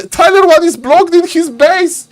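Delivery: high, excited call; a high caster's yell; excited shout. Real, not acted. Caster shouting over repeat.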